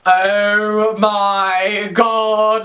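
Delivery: very slow, drawn-out amazed gasp of words; starstruck awe. Retold or imitated. Spotting people from a TV show, starstruck. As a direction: stretch every word in mock awe. Retold starstruck reaction on seeing them.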